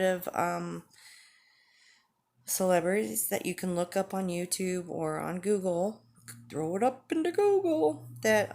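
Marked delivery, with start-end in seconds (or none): 6.5-8.5 very high, loud put-on voice; goofy aside swooping way up in pitch; playful silly voice. Vlogger telling viewers to look it up on Google, playful silly voice.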